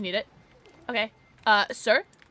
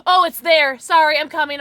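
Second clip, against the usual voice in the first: loud, higher, urgent call; hurried calling out; 4 semitones higher, 11 dB louder than usual. Real, not acted. Player telling others they are on the way, loud call. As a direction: call out loudly as you rush over. Calling out while hurrying over.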